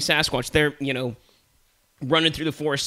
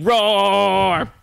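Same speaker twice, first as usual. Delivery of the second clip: loud, high, drawn-out roar; lion roar; 7 semitones higher, 7 dB louder than usual. Acted. Lion covering for his words with a loud roar. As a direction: let out a big cartoon roar. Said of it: "And then lion's like".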